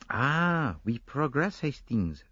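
lightly voiced Belgian detective, satisfied; pleased Poirot. Poirot telling Hastings the case moves on, lightly pleased Belgian detective. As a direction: savour a small win.

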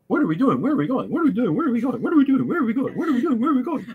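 mock the endless back-and-forth, getting shriller; whiny sarcastic sing-song loop; mocking repetition. Mocking repetitive characters' dialogue.

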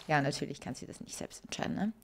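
a sly whispered aside to the viewers. Real, not acted. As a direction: whisper it under your breath, ironic. Sarcastic aside. (geflüstertes ironisches Beiseite)

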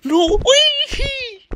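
high-pitched excited shriek; shrieking jackpot winner. Real, not acted. Finds rare scooter, shrieks.

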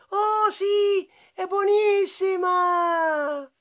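put-on high childlike protesting voice; surprised little boy. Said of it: "E lui rispose".

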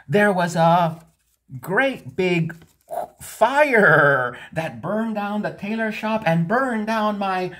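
high, rising to loud, breathless alarm; dramatic lie. Acted. The cat spinning a fiery tale to the king, building to loud alarm. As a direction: pile up the drama of the fire.

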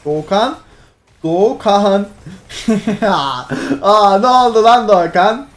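loud, laughing disbelief calling his name; exasperated yell. Real, not acted. Streamer shouting at Doğukan, half laughing. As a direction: lose it at the teammate again. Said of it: "Çıldırttın beni kardeşim".

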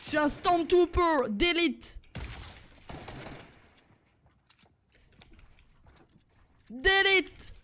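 slow, lightly pompous Stormtrooper boast; mock elite soldier. Streamer in a Stormtrooper skin, mock-proud boast. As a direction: puff up like a proud trooper, half-joking.

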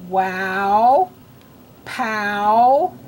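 "wow, pow" each stretched, rising; isolated "wow, pow", long lifted vowels; drilling OW words. Shown as a demonstration. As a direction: draw out each OW with a lift. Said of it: "say the ow words with me".

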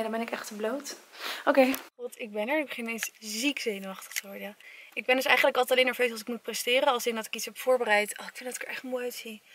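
ordinary delivery

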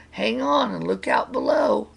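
high-pitched warning call; storyteller's alarmed crowd; crowd crying out. A narrator's reading. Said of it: "cried the crowd".

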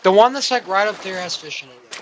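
ordinary talk, nothing marked

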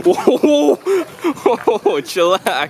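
high, loud alarmed whoas, slowing on chillax; startled whoa. Real, not acted. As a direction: back off, half laughing, half alarmed. Friend waving a weapon around.

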